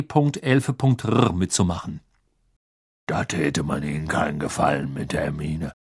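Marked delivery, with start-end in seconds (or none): 3.1-5.7 low, serious giant's voice; a big gamekeeper talking gravely to a girl; earnest Hagrid. A narrator's reading. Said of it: "sagte er mit ernster Miene".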